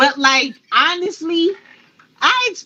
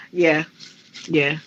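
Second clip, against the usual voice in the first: ordinary talk, nothing marked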